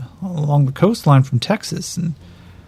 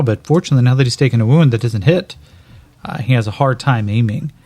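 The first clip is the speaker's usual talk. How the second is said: usual speaking voice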